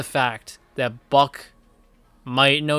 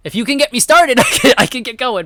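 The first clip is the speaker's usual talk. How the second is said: laughing, excited; 8 semitones higher, 7 dB louder than usual. Real, not acted. Laughing eager volunteering.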